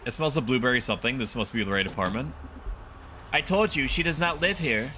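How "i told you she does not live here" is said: lightly voiced old lady, higher pitch; a hint of an indignant granny. Elderly woman turns away caller at door, lightly voiced old lady.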